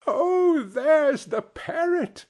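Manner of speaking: very high, drawn-out exclamation of delight; acted squeal of recognition; excited cry. A narrator's reading. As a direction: cry out slowly and high, thrilled to spot it. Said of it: "cried Scrooge".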